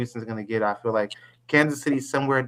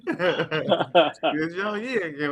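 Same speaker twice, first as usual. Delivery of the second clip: laughing taunt; 5 semitones higher, much slower than usual. Real, not acted. Teasing Rod while still laughing.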